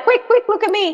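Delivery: put-on high urgent kid voice; a child pleading for a busy parent to look; child calling. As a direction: hurry them like an eager little kid. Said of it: "they'd be like".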